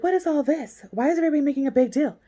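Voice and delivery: high, fast mocking whine; mock Lori. Imitating Lori brushing off the fuss, fast. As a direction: mimic her acting clueless, quick and high. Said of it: "she's like".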